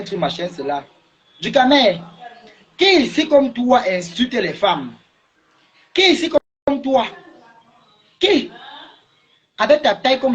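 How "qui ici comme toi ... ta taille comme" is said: il l'interpelle avec rage, la voix montant; colère croissante. Real, not acted. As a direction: question hurlée de plus en plus aigu. Dispute véhémente en direct. (apostrophe furieuse de plus en plus aiguë)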